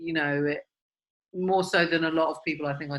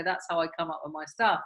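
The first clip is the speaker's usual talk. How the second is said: usual speaking voice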